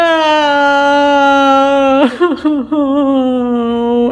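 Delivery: laughing at her own bad luck; stunned laugh. Real, not acted. She laughs in disbelief at the broken cup.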